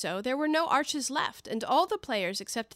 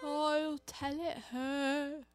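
deep hollow tone; 5 semitones higher, 5 dB quieter, much slower than usual. Acted. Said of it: "in a deep, hollow tone".

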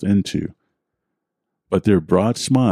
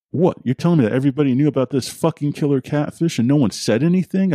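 fast, much higher, outraged near-yell; near scream; 7 semitones higher, faster than usual. Acted. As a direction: blurt it out, barely holding back a scream. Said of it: "I all but screamed".

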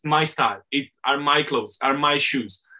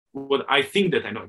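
ordinary talk, nothing marked

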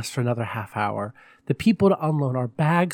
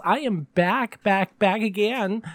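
higher, drawn-out diva hostess voice; campy drag hostess; 7 semitones higher, slower than usual. Acted. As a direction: greet the darlings like a glamorous hostess. Persona announcing return.

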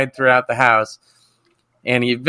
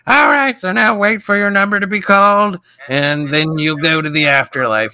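Gilbert Gottfried voice; 6 semitones higher than usual. Retold or imitated. Radio host telling the dead kid to wait, squawky.

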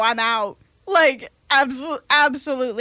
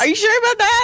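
doubtful; 9 semitones higher, 4 dB louder, faster than usual. Retold or imitated. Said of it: "She was like".

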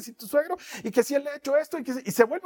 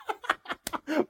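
soft giggle trailing off; laughing; 7 semitones higher, 5 dB quieter than usual. Real, not acted. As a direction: laugh quietly to yourself. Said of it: "Perdón que me ría tanto". (risita suave)

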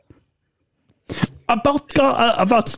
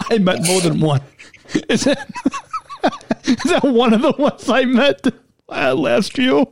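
laughing; 2 semitones higher than usual. Real, not acted. Asking the co-host about people met last year, laughing. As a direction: talk through bursts of laughter.

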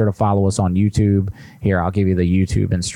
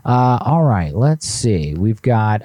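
normal talking voice

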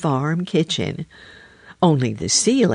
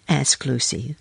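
ordinary delivery, nothing marked

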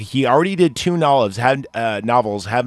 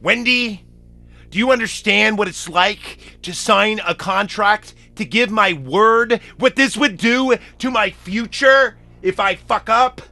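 higher, strained frustrated husband; Jack; 8 semitones higher than usual. Retold or imitated. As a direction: plead it up high in frustration. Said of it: "when Jack goes".

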